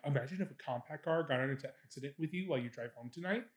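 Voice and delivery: quick, soft inner voice; hushed, hurried musing; brain's idle thought. Retold or imitated. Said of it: "my brain's like".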